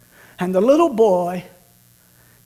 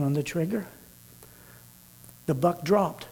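hushed storytelling; 5 semitones lower, 7 dB quieter than usual. A narrator's reading. Preacher lowering his voice for the hunting scene, hushed. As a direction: draw the congregation in with a hushed voice.

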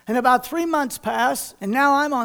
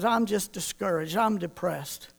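low discouraged voice; 5 semitones lower, 9 dB quieter than usual. Retold or imitated. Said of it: "he wasn't doing well".

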